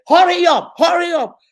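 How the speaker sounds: loud, high, impatient "hurry up" shouted twice; impatient mom shout. Retold or imitated. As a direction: bark it like a rushed mother. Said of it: "you will say".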